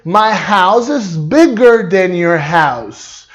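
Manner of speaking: slow, careful English; slow model sentence. Shown as a demonstration. Teacher modelling the comparative slowly. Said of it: "em inglês eu vou ter que dizer".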